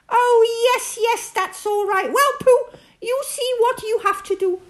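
the one in charge; bossy Rabbit. Acted. Rabbit briskly instructs Pooh, high and fussy.

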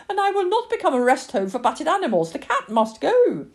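high, loud, emphatic mother; exasperated refusal. Acted. Mother rules that the cat must go, loudly emphatic. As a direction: put your foot down, slowing on the verdict.

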